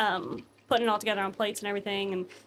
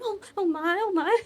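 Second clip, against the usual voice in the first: higher, fluttery oh my; a hint of a fussing lady; flustered mother; 7 semitones higher than usual. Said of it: "you hear her going".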